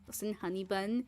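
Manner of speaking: earnest heart-to-heart. Retold or imitated. Streamer having a healthy conversation with a chatbot, earnest and low. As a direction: gently reason with them, mock-serious. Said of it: "genuinely healthy conversation".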